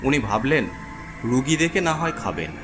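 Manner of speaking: usual speaking voice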